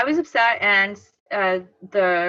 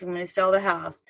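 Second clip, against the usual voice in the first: ordinary talk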